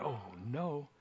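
very soft, low mock dread; hushed, acted reluctance; reluctant dread. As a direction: shrink back under your breath. Acting reluctant listener told to speak.

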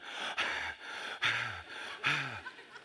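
heavy winded breaths, imitated; out-of-breath panting. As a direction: pant as if just back from a run. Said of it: "I have not calmed down yet".

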